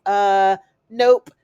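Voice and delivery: loud, punchy retold no; mock flat refusal. Crew shoots down Theodora's plan, loud mock refusal. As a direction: bark the no like a stubborn crew.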